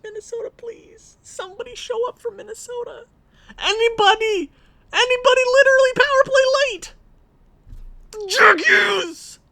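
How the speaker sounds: very high, building to loud shout; plead, then scream for a hero; desperate high shout. Real, not acted. Fan pleading for a late goal, building to a high shout.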